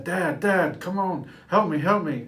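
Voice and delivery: high, loud panicked yell; yelling for dad. Retold or imitated. Boy on the pier yelling for his dad, loud and high. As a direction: call for help at the top of your voice. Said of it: "yelling for my dad".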